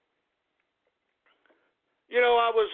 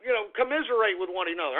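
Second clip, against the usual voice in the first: ordinary talk, nothing marked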